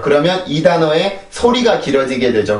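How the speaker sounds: ordinary talk, nothing marked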